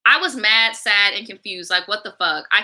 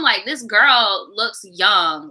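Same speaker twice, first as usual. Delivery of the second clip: ordinary talk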